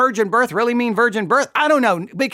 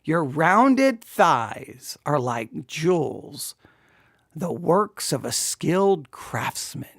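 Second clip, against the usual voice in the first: very slow, drawn-out reading, lower in pitch; savoured verse reading; 4 semitones lower, much slower than usual. Acted. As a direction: linger teasingly on every word.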